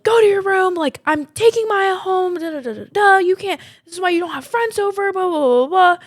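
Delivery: high, mocking yell of an angry mom; imitated yelling. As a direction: yell it in a put-on high voice, scolding. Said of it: "like, yelling at her".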